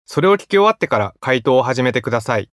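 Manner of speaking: ordinary talk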